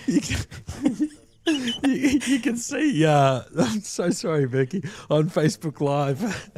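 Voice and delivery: high-pitched giggly speech, can barely get words out; talking through real uncontrollable laughter; laughing. Real, not acted. Commentator apologising to the stream while laughing helplessly. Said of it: "You just make me laugh".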